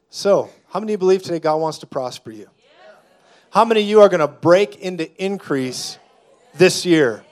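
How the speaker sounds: loud rallying call; rallying preacher. Real, not acted. Preacher rallying the congregation to praise, loud. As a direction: lift the room up.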